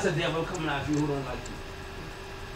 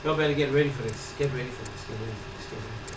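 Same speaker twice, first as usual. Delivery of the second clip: low, fading repetition; hushed warning; 8 semitones lower, 6 dB quieter than usual. Real, not acted. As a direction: let each repeat sink lower and softer. Repeats warning, fading away.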